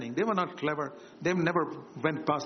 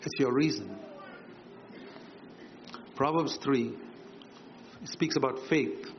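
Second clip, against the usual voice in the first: ordinary talk, nothing marked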